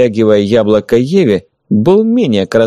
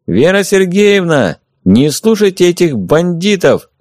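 raised, higher, slow call to a woman inside; calling from outside; 8 semitones higher than usual. A narrator's reading. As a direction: call out to her from outside the building. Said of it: "позвал снаружи тот же голос".

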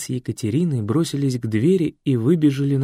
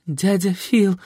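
sobbing; 7 semitones higher, 3 dB louder, slower than usual. Acted. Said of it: "всхлипнула та".

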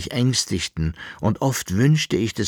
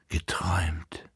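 soft, unvoiced whisper; 4 semitones lower, 7 dB quieter than usual. A narrator's reading. Esther waking alone in her room, whispering. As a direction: breathe the word to yourself. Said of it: "flüsterte sie".

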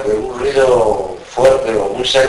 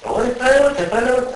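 much higher, pleading imitation; mock high robber voice; 12 semitones higher than usual. Robber urging the gunman to stop, in a high mock voice. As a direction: squeak it out like the nervous robber.

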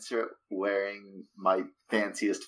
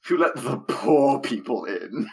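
snooty sneer; 2 semitones higher than usual. Acted. Snobby noble mocks the newcomers.

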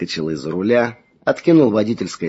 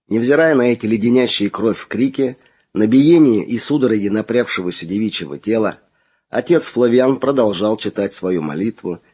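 usual speaking voice

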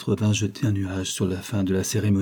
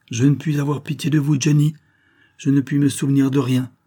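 fierce refusal; 5 semitones higher, 5 dB louder than usual. Acted. Agnès refusing pity fiercely.

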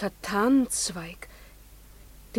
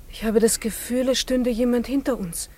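usual speaking voice

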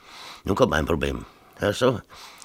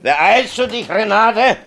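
higher, louder impatient call; husband yelling at his wife to hurry up, higher and louder; sharp call to wife; 7 dB louder than usual. Acted. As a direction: snap at her to hurry. Mayor snapping at wife.

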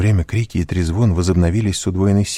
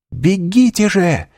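высокий протяжный жалостливый крик; крикни, умоляя спасаться; 13 semitones higher, slower than usual. A narrator's reading. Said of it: "крикнула мадмуазель де Армельи". (протяжный крик)

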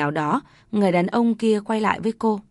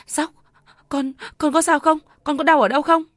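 tearful worry; 7 semitones higher than usual. A narrator's reading. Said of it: "Nhài òa khóc".

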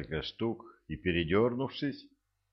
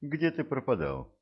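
surprised; 8 semitones higher than usual. Acted. Wife greets missing husband.

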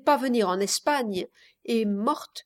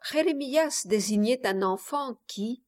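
usual speaking voice

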